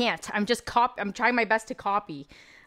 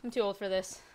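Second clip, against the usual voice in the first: ordinary talk, nothing marked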